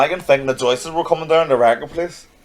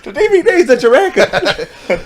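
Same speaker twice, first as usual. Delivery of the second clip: higher, slow, laughing imitation; laughing mock quote; 10 semitones higher, slower than usual. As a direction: drawl the phrase out through a laugh. Repeats fan quote, laughing.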